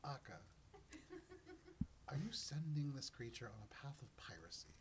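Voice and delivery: lightly voiced, hushed questioner; Mister Gift. Mister Gift questions Akka about piracy, in a lightly hushed voice. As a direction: question with a touch of husky whisper. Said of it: "and then says".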